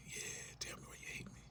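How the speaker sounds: breathy whisper of the same plea. Retold or imitated. Whispered plea to be told why they're hated. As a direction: breathe it out, barely voiced.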